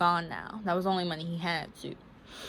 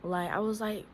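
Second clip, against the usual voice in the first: ordinary talk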